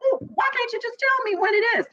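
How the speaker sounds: high, fast, pushy godson; mock godson pressing. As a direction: push for an answer, quick and high. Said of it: "so he was like".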